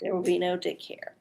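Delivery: normal talking voice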